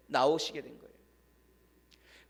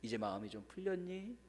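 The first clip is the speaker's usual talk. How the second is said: much softer, tender; gentle quiet question; 16 dB quieter than usual. Retold or imitated. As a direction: ask softly with a hand on his shoulder. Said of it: "조용히 다가가서".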